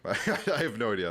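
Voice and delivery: laughing through the words. Real, not acted. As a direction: chuckle while saying it. Repeats it laughing.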